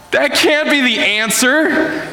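high, drawn-out protest of disbelief; imitated frustrated daughter. Daughter objecting to her parents' homework help, frustrated. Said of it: "like, disappointed. She gets frustrated".

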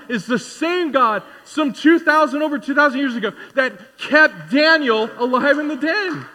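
high, loud pulpit proclamation, slowing on key lines; full-force preaching. Acted. Pastor declaring God's power to the congregation, full force. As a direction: proclaim it to the whole room.

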